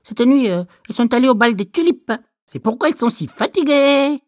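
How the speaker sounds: high, loud, fast chattering ladybug. Acted. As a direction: gossip it out quickly, bright bug. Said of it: "dit belle la coccinelle".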